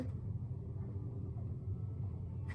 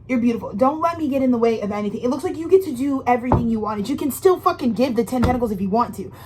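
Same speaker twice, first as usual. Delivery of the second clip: normal talking voice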